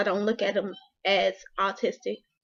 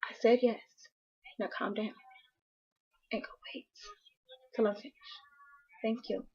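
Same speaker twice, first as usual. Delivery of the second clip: hushed scolding; 10 dB quieter, faster than usual. Real, not acted. Mother hushing child mid-recording.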